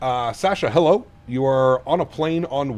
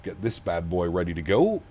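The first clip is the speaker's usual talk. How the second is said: ordinary talk, nothing marked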